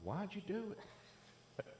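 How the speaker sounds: high and slow mock mother voice; hammed-up nagging mother; mocking his mother. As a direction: whine it like a nagging mom. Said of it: "She's always like".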